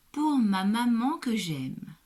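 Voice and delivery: put-on reading the title to a child; poem reading. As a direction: announce the poem title slowly and clearly. Poem title read aloud.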